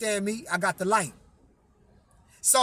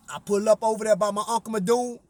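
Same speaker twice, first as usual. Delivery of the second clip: normal talking voice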